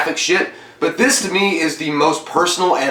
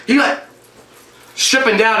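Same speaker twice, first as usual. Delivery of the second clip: ordinary delivery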